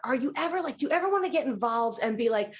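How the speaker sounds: ordinary talk, nothing marked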